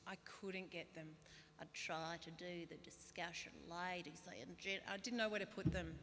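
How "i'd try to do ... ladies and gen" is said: slow politician impression, lightly put on; a hint of a deliberate, drawn-out speech-maker; Julia Gillard. Comedian attempting the impersonation for the crowd, slow politician voice. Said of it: "People love this impersonation".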